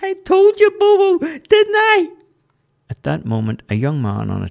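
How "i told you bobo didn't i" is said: very high and loud tiny voice; loud high cartoon creature voice; squeaky Charlie voice. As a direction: squeak it out, told-you-so. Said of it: "Charlie replied".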